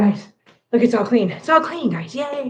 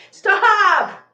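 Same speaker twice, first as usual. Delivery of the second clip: loud, high command to the cats; 1 sharp stop; 8 semitones higher, 7 dB louder than usual. Real, not acted. Streamer ordering her cats off the pile, loud. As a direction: bark it at the cats.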